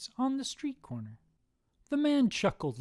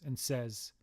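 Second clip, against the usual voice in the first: ordinary delivery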